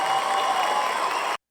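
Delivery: very loud, distorted thank-you; booming effect. Pretend. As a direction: blast it out, drawn long. Booms out over the audience.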